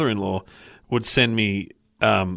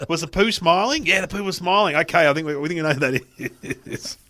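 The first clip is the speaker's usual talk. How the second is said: high, playful mock police officer; mock cop questioning; 7 semitones higher than usual. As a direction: play the cop solemnly asking about the poo, voice raised high.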